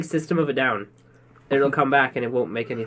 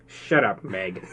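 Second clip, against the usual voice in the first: Peter Griffin; 7 semitones lower, 5 dB quieter, much slower than usual. Retold or imitated. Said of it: "talk just like Peter Griffin".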